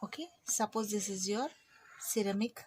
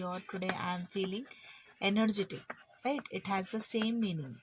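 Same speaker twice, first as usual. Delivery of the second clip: ordinary talk, nothing marked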